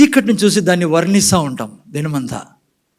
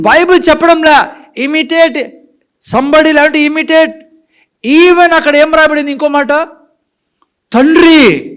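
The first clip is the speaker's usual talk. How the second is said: preaching at full force; 10 semitones higher, 6 dB louder than usual. Acted. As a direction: lift it up and proclaim. Preacher's high, loud peak. (ఎత్తైన స్వరంతో బిగ్గరగా బోధించడం)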